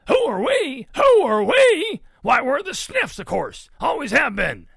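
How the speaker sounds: high, booming, incredulous shout; blustering Papa pig. A narrator's reading. Papa pig introduces the Sniffs to Ruby, booming. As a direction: boom it out as a boastful hog.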